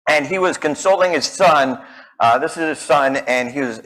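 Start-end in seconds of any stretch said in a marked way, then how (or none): none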